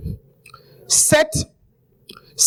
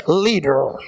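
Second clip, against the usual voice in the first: low "leader" in a French speaker's accent; 7 semitones lower than usual. Shown as a demonstration. Preacher showing off his accent, low accented "leader". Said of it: "j'aime mon accent".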